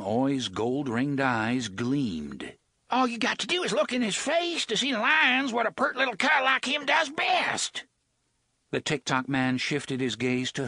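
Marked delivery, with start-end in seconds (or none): 2.9-7.9 high, sneering gutter voice; a pitched-up sneer from a rogue; high whiny thug. A narrator's reading.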